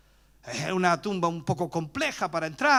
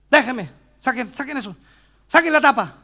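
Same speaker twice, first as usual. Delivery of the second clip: un toque de hermano exaltado que grita; 5 semitones higher, 8 dB louder than usual. Retold or imitated. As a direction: pide a gritos que abran la tapa. Hermano loco en el funeral. (hermano loco exigiendo en el funeral, algo fuerte)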